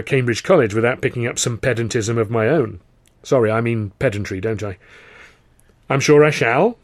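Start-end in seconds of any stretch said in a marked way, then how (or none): 5.9-6.8 smug, loud retort; smirking. Acted. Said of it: "Charlie smirked".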